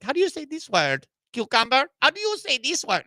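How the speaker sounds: high-pitched put-on Spanish accent; mock Spanish accent. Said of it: "she'll, like, randomly ask".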